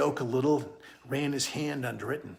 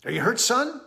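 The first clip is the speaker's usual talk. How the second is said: concerned father; 7 semitones higher, 5 dB louder, faster than usual. Acted. Worried father calls to son.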